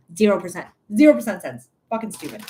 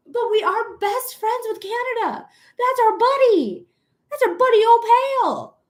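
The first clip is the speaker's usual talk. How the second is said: high, fond gushing; high excited praise for Canada; 10 semitones higher than usual. Real, not acted. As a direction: coo about your buddy.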